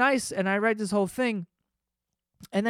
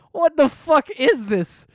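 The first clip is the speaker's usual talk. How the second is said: laughing disbelief; 9 semitones higher, 7 dB louder, slower than usual. Retold or imitated. Reading a confusing letter.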